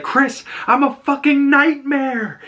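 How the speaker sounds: high, drawn-out mock outburst; snapping, near tears. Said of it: "she just kinda snaps and almost breaks down into tears".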